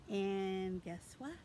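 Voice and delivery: ordinary talk, nothing marked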